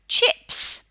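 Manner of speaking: chips said with a clear English I; English accent. Shown as a demonstration. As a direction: say chips the English way, short crisp I. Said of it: "the children hear me say".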